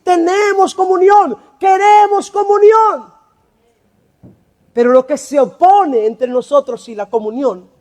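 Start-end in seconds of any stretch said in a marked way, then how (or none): 0.0-3.1 higher, louder shout, slowing on queremos; shouted proclamation. Shown as a demonstration. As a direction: cry it out to the congregation, slowing down. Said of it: "Esto es lo que deberíamos decir". (proclamación gritada, más aguda y lenta)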